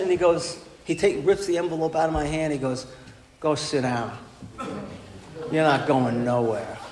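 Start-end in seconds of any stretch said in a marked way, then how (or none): 3.4-6.8 low, slow, commanding big man; gruff order. Retold or imitated. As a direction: lay down the law like a tough counselor. Big ex-football player orders him.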